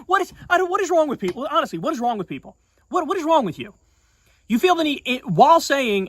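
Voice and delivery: high-pitched, incredulous questioning; exasperated disbelief. Real, not acted. Podcaster fed up with people hedging on free speech, exasperated. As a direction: plead in real disbelief, pitch jumping well up.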